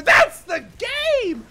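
very high, loud ecstatic yelling; elated shouting; victory scream. Real, not acted. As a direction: scream like you just won. Winning the game.

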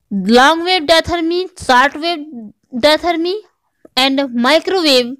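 normal talking voice

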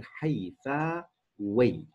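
حيفاوي drawn out slowly, syllable by syllable; slow teaching model of حيفاوي; syllable-by-syllable demonstration. Shown as a demonstration. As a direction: stretch each part of حيفاوي for learners. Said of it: "فالنسب إلى كلمة حيفا بصير".

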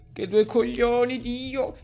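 slow, high, loud groaning curse; long-drawn cry of frustration; exasperated yell. Real, not acted. Exasperated at being trapped.

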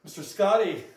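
loud call into the empty room. Real, not acted. Calling out to a ghost.

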